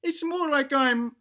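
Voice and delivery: put-on high-pitched old granny voice; granny ghost. Granny ghost explaining her superpowers to family, high granny voice. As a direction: play a proud granny in falsetto, drawing out the final name. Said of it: "and she says".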